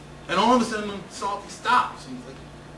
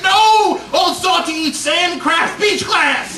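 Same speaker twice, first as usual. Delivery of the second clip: much higher and louder panicked yell; frantic shout; 11 semitones higher, 10 dB louder, slower than usual. Retold or imitated. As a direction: scream it in panic. Old guy shouting.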